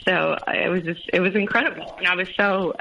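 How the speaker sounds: ordinary delivery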